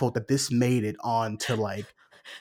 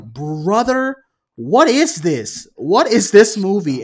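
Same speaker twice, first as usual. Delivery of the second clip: louder, slowed outraged what is this; loud disbelief; 4 semitones higher, 8 dB louder than usual. Retold or imitated. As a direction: throw up your hands at the screen. Reacting to absurd movie scene.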